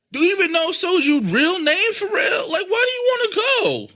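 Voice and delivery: cracking with real exasperation; exasperated rant. Real, not acted. Venting to friends about unwanted guests, rising exasperated cry. Ranting about courtesy invites.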